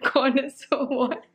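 giggly and high, failing to say the name; can't keep a straight face on Coco; laughing through it. Real, not acted. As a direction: laugh while trying to get the word out. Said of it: "I can't say it in a straight accent".

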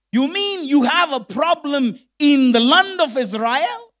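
loud, high-pitched rhetorical questions; acted outraged disbelief, each question lifted; incredulous challenge. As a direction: fire back in ringing disbelief.

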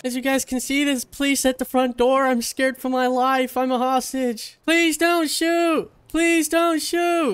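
hammed-up terrified hostage, high and loud; high panicked pleading. Hostage pleading to police cameras.

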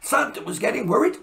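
usual speaking voice

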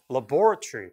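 laboratory as "la-BOR-tree"; British pronunciation. Shown as a demonstration. Teacher confirming for students, British laboratory stressed on BOR. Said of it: "그렇게".

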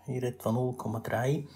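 ordinary talk

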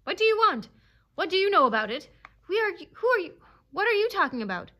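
high, loud, frantic questions; yelped challenge. A narrator's reading. Dog challenging the jeering animals, yelping. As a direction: fire questions in rising outrage. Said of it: "he yelped".

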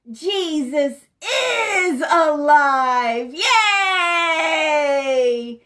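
slow, loud "alive" with a laugh, then a shouted yay; joyful cheer. Acted. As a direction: celebrate it for the children. Cheering Jesus is alive for kids.

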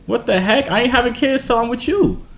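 ordinary delivery

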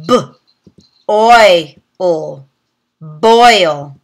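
"B, oi, ul" said as separate sounds, then blended into "boil"; segment, then blend; sounding out. Shown as a demonstration. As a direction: phonics teacher sounding out. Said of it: "practice the oi sound".

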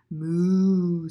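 loud, drawn-out moo; calf-like moo. A narrator's reading. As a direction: moo like a calf with a mouthful of milk. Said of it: "like a calf with a mouthful of milk".

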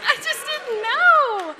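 high, loud, drawn-out comic wail; mock bawling. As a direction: wail it slowly through laughter.

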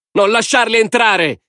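slow, very high-pitched woman's cry; drawn-out high, hostile shout. A narrator's reading. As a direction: cry out in protest from the crowd. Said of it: "gridò un'altra donna".

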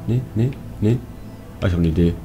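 ordinary talk, nothing marked

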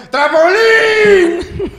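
high, long-drawn song request; drunken fan yelling out a request; mock heckler's shout. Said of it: "cada canción gritaba".

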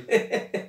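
short unvoiced laugh; breathy giggle. Child giggles at the joke, breathy laugh. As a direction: giggle softly.

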